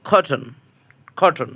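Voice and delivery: কটন said with a clear k sound at the start; hard c sound. Shown as a demonstration. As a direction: open কটন on a crisp k. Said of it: "এখানে সির উচ্চারণ হয়েছে ক".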